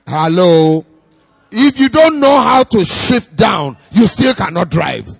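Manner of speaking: loud, shouted sermon call-out; fiery preaching. Acted. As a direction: rouse the congregation, shouting. Preaching at full force.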